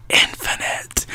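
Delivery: a soft, voiceless echo of the word; whispered echo. Acted. Guide echoes how many perspectives remain, whispered. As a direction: whisper INFINITE, hushed and mysterious.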